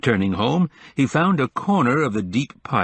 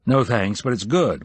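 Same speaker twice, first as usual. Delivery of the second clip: ordinary delivery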